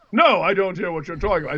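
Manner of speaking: imitated Australian actor protesting; mock Australian accent, puzzled denial. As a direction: answer back in a put-on Australian accent. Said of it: "he became Australian".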